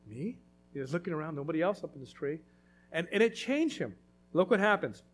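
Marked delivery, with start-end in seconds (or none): 0.1-0.4 small, soft, low disbelieving me; surprised me. Acted. Zacchaeus up the tree, called by Jesus, softly stunned. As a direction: shrink in surprise.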